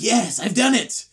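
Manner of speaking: triumphant cheer. Retold or imitated. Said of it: "It's like".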